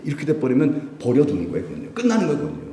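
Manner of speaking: ordinary talk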